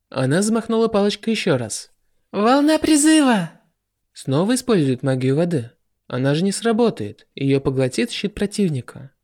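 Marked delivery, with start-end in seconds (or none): 2.3-3.5 high, drawn-out spell call; spell shout. A narrator's reading. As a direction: call out the spell name slowly and proudly. Reimi casts a spell.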